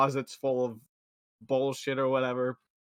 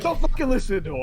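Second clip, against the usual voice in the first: mock angry outburst; 7 semitones higher, 7 dB louder, faster than usual. Mimics Khonshu's angry interjection.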